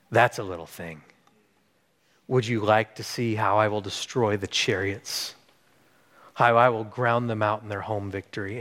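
low, soft, measured reassurance; hushed, deliberate promise with long pauses; calm, assured God. Retold or imitated. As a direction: answer quietly with unhurried authority. Voices God's reassuring answer.

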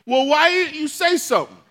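loud and high, half-scolding; a loud call across the hospital room. Retold or imitated. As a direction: shout it across the room, indignant. Kevin calling across the room.

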